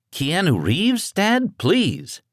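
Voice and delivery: put-on high, drawn-out teen exasperation; exasperated teenage son. A narrator's reading. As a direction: roll your eyes at your dad.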